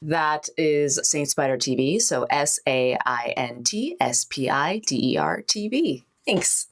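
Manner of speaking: ordinary talk, nothing marked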